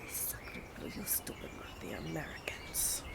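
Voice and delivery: put-on muttered under breath in French accent. French envoy insulting the Americans, whispered French accent. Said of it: "horrible attempt at a French accent".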